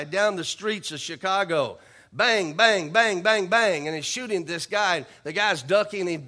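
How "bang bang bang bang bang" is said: bang bang, punchy and loud; gunshot sounds. Retold or imitated. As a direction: shout out the gunshots. Gunshot sounds in chase story.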